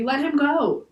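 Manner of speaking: high-pitched pleading cry; screamed plea. A narrator's reading. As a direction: beg for his release. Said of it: "screamed Annie".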